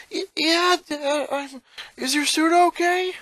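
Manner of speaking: put-on high, shaken boy voice asking about the suit; nervous, eager to please; stammering boy. Boy asking his father about the suit, shaky stammer. Lincoln voice in story.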